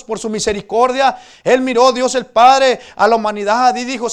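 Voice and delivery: predicación fuerte, con voz proyectada; proclámalo con fervor ante la congregación; prédica enérgica. Real, not acted. (prédica encendida)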